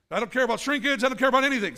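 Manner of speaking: mock bravado, punched up; gung-ho. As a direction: throw it out boldly, all in. Acting a reckless pool jumper.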